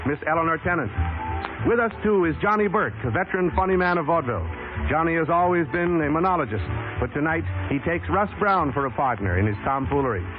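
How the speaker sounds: ordinary talk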